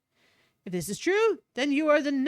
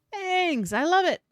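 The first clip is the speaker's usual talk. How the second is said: higher, laughing with delight; laughing thanks; 5 semitones higher, 4 dB louder than usual. Acted. Delighted by new weapon.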